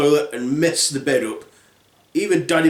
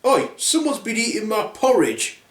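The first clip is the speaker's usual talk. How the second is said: lightly voiced bear, higher pitch; indignant bear; 7 semitones higher than usual. Bears finding their porridge eaten, indignant. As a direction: complain like a put-out storybook bear. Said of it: "the three bears were like".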